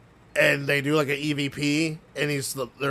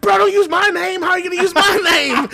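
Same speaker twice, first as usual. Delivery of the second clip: much higher, louder mock outrage, laughing through it; imitating the outraged friend; 10 dB louder than usual. Retelling a friend outraged his name was used, high laughing squeal. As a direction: squeal it like a betrayed buddy. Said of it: "He's like".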